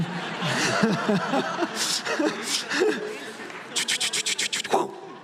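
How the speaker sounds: a long, high laugh. Real, not acted. Laughing at his own story, long laugh. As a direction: laugh it off fully.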